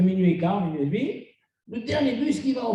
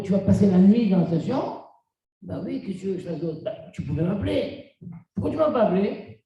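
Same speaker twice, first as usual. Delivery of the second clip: usual speaking voice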